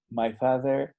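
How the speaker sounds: English with a Mexican Spanish accent, slow and halting. Shown as a demonstration. Teacher demonstrates a beginner's Spanish-accented English. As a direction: learner speaking English with a heavy Spanish accent.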